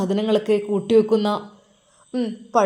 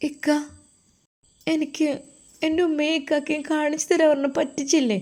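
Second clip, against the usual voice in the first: put-on a child who feels tricked, asking in a small voice; hurt young girl; 6 semitones higher than usual. Girl accusing Ajmal of tricking. (പറ്റിച്ചില്ലേ എന്ന് ചോദിക്കുന്ന പെൺകുട്ടിയുടെ ഉയർന്ന ശബ്ദം)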